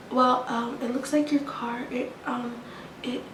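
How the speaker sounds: soft, hesitant mock whisper of the clerk. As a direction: imitate the clerk barely whispering. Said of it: "why do you keep whispering".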